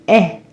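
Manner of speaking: "eh" as a short open vowel, like in egg; short vowel sound. Shown as a demonstration. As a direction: say "eh" cleanly and briefly. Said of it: "Short E is the sound".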